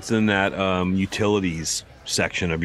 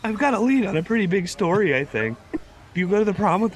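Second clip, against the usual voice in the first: squeaky pitched-up schoolboy; prepubescent boy voice; 12 semitones higher than usual. Acted. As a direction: play a nervous teen boy, pitched up high. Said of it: "I don't know how to do a prepubescent boy's voice consistently".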